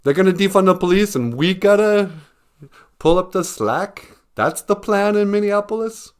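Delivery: high, loud startled disbelief; panicked social workers. Retold or imitated. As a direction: squawk it in alarm. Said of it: "They're like".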